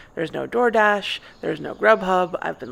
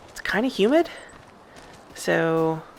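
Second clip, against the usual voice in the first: ordinary talk, nothing marked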